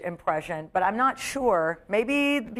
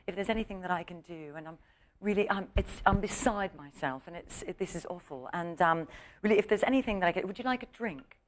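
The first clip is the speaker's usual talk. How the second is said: put-on soft, hushed apologetic British accent; a quiet, over-polite British voice; 8 dB quieter than usual. [name] apologizing to the prankster for everything, in a hushed British accent. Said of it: "she had that British accent".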